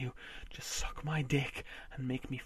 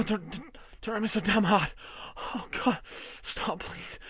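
higher, strained begging; pleading; 7 semitones higher than usual. Acted. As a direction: beg her to ease off. Overwhelmed, pleading.

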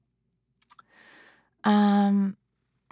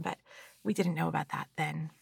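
ordinary talk

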